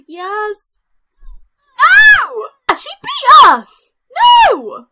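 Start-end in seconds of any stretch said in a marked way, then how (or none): 1.8-4.9 high, loud wail of defeat; anguished gamer shriek; outraged scream. Real, not acted. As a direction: scream it in disbelief. Loses duel to rival.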